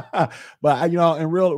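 laughing. Real, not acted. Laughing through words.

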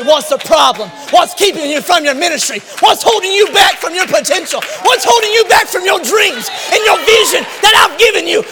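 rising, loud, pleading questions; fervent preaching. Acted. The Father searching for the sulking believer, loud rising pleading.

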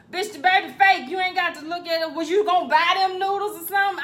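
loud, sassy put-down; sassy retort. Retold or imitated. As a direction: brush him off with loud sass. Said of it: "The lady was just like".

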